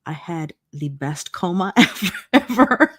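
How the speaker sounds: laughing through the words. Real, not acted. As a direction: giggle out the last ever. Laughing at own joke.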